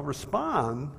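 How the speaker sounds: respond drawn out slowly with a rise; respond, slow and rising, set apart; stress on respond. Shown as a demonstration. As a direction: lean on the word respond. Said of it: "is the correct word".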